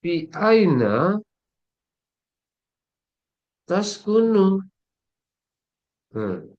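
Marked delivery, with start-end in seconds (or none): 0.0-4.6 Fi aina taskunu said very slowly and higher; slow formal Arabic reading. Shown as a demonstration. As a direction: carefully spell out the full standard Arabic Fi aina taskunu. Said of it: "bahasa futsal ya".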